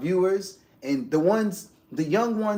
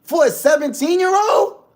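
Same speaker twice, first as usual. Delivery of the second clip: higher, louder mock outrage; retold outburst; 11 semitones higher, 9 dB louder than usual. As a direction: rant at someone for whispering in their own house, rising to a shout. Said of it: "I'm like".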